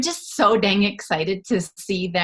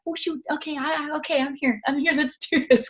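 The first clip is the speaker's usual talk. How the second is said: higher, flustered self-reminder; flustered catch-up; 8 semitones higher than usual. Retold or imitated. Leader remembering her forgotten team, higher and flustered. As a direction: scramble back to your people, higher and hurried. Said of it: "I'm like".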